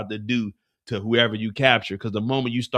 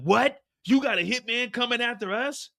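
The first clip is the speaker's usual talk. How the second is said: higher, disbelieving questions; shocked; 11 semitones higher than usual. Acted. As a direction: demand it in disbelief. Tommy shocked at news.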